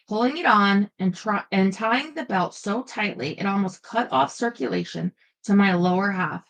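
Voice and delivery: plain reading voice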